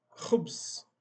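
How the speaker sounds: Khubz with a rough throaty kh; Arabic khubz. Shown as a demonstration. Teacher demonstrating Arabic word for bread, throaty kh. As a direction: say Khubz with a scraped kh from the back of the throat. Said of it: "Khubz quer dizer pão em árabe".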